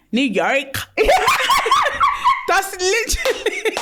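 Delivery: bursting into high laughter; real laughing outburst; laughing shout. Real, not acted. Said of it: "is something in your mouth?".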